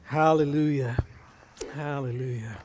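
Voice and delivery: low, soft amens, fading away; hushed praise. Real, not acted. Pastor closing the prayer to the congregation, hushed praise.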